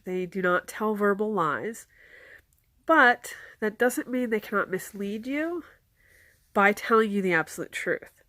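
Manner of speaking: usual speaking voice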